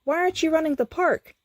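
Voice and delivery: high, rushed alarmed question; urgent confusion. A narrator's reading. Skips alarmed at Benson.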